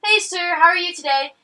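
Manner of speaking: cheery greeting. Retold or imitated. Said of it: "I was like".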